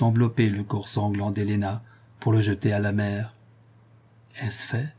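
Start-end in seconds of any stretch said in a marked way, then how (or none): 4.4-4.9 bandit asking under his breath, much softer; a conspirator's hushed question on the stairs; half-voiced. A narrator's reading. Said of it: "demanda le bandit à demi-voix".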